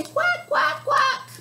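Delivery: put-on high quacks for the kids to echo; duck call. Shown as a demonstration. Librarian prompting kids to quack back. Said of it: "You say".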